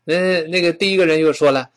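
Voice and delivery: ordinary talk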